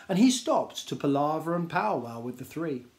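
plain reading voice

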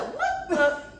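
high startled what the; shocked. Retold or imitated. Telling a friend her shocked reaction to the ghost talk. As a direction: blurt it out in shock, half laughing.

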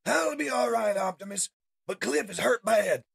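loud, high urgent report; urgent captive. Acted. Freed captive tells Optimus a friend is hurt, urgent.

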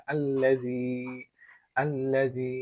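"আল্লাযী" with light dh and a held final ee; thin, soft dhal. Shown as a demonstration. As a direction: model "আল্লাযী" as al-la-dheee. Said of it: "জি যা হচ্ছে পাতলা শব্দ". ("আল্লাযী"-তে পাতলা যাল ও টান)

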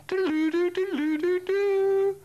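very high, loud electronic trill; piercing mobile ring noise; imitated ringtone. A lone phone ringing in civilization's wreckage, piercing high trill.

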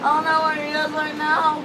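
ordinary talk

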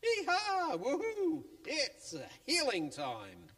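high, loud yee-haw cheer; gleeful cheer rising to a squeal; excited whoop. Acted. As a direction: whoop like cowboys off to a party. Friends cheering on their way.

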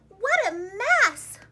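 usual speaking voice

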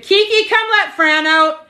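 high, slow call across the house. Real, not acted. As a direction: call to someone in another room. Calling out to someone at home.

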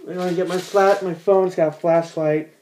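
ordinary talk, nothing marked

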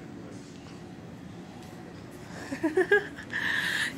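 soft breathy laugh. Real, not acted. Laughing at stranger.

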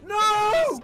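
high, loud wailing no; panicked scream. Real, not acted. Seeing too many zombies coming, panicked scream. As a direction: cry out in game panic.

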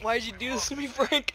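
spent from laughing. Real, not acted. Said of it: "I can't breathe".

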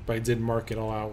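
ordinary talk

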